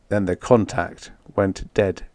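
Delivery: ordinary delivery, nothing marked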